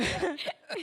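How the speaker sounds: short rising nervous giggle; nervous chuckle mid-sentence; nervous laugh. Real, not acted. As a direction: laugh through stage fright. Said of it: "I'm calming down as I'm speaking".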